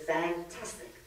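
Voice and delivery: a bright, polite reply with a lift at the end; polite fantastic. Retold or imitated. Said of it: "So again, the third lady says".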